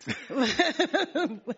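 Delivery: amused little chuckle; short laugh. Real, not acted. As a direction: laugh at her own joke. Laughs after "me too.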